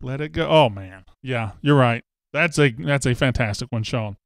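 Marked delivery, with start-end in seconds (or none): none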